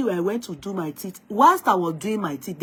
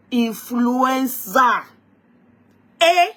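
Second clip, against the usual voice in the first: slow drawn influenza; 4 semitones higher, 5 dB louder, much slower than usual. Real, not acted. Drawn-out dramatic word.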